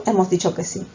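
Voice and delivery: normal talking voice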